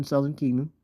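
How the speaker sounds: usual speaking voice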